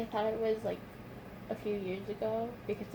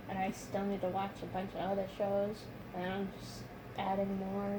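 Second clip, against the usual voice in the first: usual speaking voice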